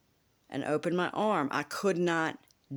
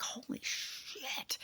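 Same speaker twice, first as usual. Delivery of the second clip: hushed holy shit; 10 semitones higher, 11 dB quieter, much slower than usual. Retold or imitated. Telling about the morning pain, a slow stunned whisper. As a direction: breathe it out slowly, stunned. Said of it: "I was like".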